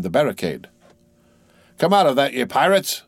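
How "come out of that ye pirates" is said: roaring captain. A narrator's reading. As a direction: bellow it like a ship's captain. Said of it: "roared the captain".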